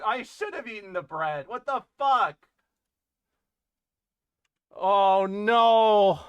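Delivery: normal talking voice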